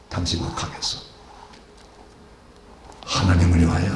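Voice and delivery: "당신과" low and breathy, "하나님을" rising, near whispered; hushed vow. Acted. Deborah vowing to go with Barak, hushed and solemn.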